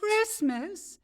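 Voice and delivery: high, rising disbelief on Christmas?; incredulous echo. Acted. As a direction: throw the word back at him, stunned. Incredulous echo of his word.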